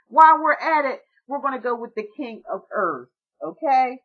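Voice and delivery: sudden loud, high rush of excitement; excited burst. Real, not acted. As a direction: burst in, thrilled to announce the card. Excited jump to next card.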